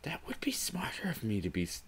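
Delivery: whispered aside; whispering. Real, not acted. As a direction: whisper it to yourself. Self-remark aside.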